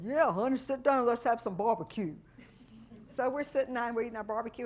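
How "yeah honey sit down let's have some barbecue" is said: folksy dealer. Retold or imitated. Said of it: "And he said".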